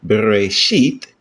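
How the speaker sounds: Bereshit drawn out slowly, stress on the last syllable, shit; slow Bereshit with a rising, accented ending; stress on final syllable. Shown as a demonstration. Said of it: "we put the accent on sheet".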